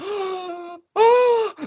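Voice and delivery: high, drawn-out starstruck gasp; shocked gasp. Retold or imitated. Fan recognized by a wrestler, mock shocked gasp. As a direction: act out being stunned at being recognized. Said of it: "I'm kinda shocked".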